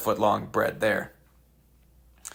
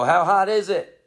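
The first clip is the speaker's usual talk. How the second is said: crowd call-back; 7 semitones higher, 4 dB louder than usual. Retold or imitated. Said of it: "people will go".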